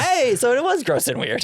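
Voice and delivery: squeaky high sarcastic retort; high mocking voice. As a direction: pitch it way up, mocking. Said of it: "And I was like".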